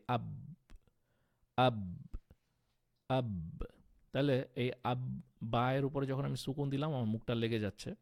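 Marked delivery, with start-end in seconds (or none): 0.1-3.6 আব with closed lips that spring open; qalqala demo. Shown as a demonstration. Teacher demonstrates qalqala on আব for students. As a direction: bounce the ব in আব off the lips. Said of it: "আরেকটা example".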